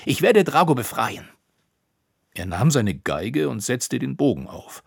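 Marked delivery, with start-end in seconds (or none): 0.0-1.3 a high, loud angry cry. Acted. Koníček objects to Roppucher, loud high cry. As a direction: shout it out in outrage, higher and louder. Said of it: "rief er".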